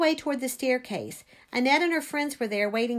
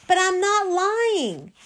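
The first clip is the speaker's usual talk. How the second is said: high, loud, slow protest; drawn-out, indignant plea; pleading protest; 8 semitones higher, 5 dB louder, slower than usual. A narrator's reading. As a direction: protest your innocence. Accused child protests to the priest.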